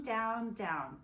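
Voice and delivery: ordinary delivery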